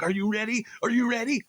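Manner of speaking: high, anxious repeated question; frazzled panic. Retold or imitated. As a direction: ask it like a panicking organizer. Frazzled stage organizer panicking.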